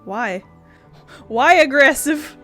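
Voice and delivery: ordinary delivery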